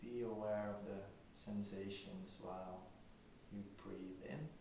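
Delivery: quiet, unhurried meditation voice; guided meditation. Acted. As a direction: gently guide them into stillness, leaving long silences.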